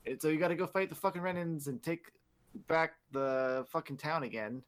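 usual speaking voice

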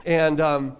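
ordinary talk

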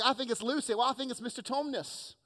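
high, put-on British kids' voices; British accent. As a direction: imitate eager children in mock British accents. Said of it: "go into a British accent".

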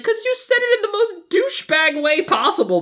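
high-pitched exasperated outburst; indignant retort. Acted. Arguing back at movie character.

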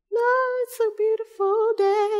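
half-sing it, playing oblivious; carefree singsong. Man pretending all is fine at red light, carefree singsong. Mimics Bob oblivious in car.

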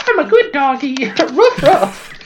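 high, loud laughing dog voice; cartoon dog. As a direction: yap it like a happy puppy through laughter. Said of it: "be like".

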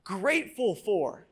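slow welcoming group-leader question; inviting question. Retold or imitated. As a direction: draw out the question like opening a group. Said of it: "kick off the gathering with".

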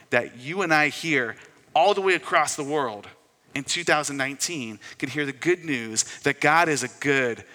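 loud, driving sermon delivery, building phrase on phrase; full-force preaching. Real, not acted. Preacher proclaiming good news to the congregation, full-force preaching. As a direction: proclaim it with big emphatic stresses.

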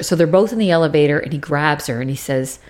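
ordinary talk, nothing marked

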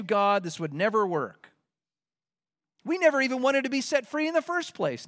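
high, whiny blaming voice; sulky complainer. Retold or imitated. Israelites sulking at God, high and whiny. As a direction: whine at God like a sulking child. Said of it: "voicing Israel's complaint to God".

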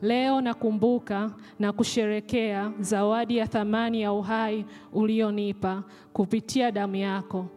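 ordinary talk, nothing marked

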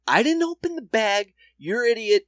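acting out a wronged suspect; mock indignant protest. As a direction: protest your innocence in pretend outrage. Acted indignant defence to guards.

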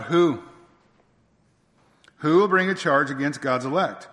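courtroom summons. Acted. Preacher as court crier summoning accusers, loud ringing call. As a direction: call out the challenge to the whole courtroom. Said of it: "And the call goes out".

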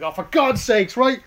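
loud, high, fed-up rant; exasperated loud rant. Retold or imitated. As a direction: complain loudly, pretending to give up. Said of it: "we're like".

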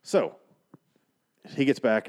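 usual speaking voice

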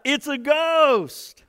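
high, loud frightened cry of a ghost sighting; terrified disciples crying out; fearful cry. A narrator's reading. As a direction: cry out in terror, drawn out. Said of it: "they cried out".

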